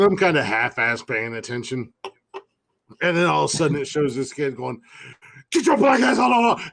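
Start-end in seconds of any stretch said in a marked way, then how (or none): 5.5-6.7 high, loud mock shout; imitated kid yelling. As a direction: imitate a kid hollering in a video.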